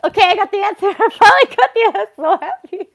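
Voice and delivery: finally got the answer, squealing for joy; thrilled. Real, not acted. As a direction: burst out with the news, giddy. Finally gets the reading answer.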